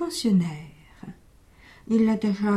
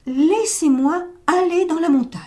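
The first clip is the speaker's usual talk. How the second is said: pleading goat; 6 semitones higher, 4 dB louder than usual. Acted. Goat insists on leaving.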